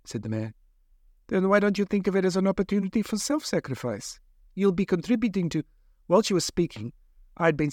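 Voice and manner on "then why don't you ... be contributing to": a lightly voiced, higher doctor, reasoning; hint of a persuasive lab doctor. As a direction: play the coolly reasoning scientist, pitched up.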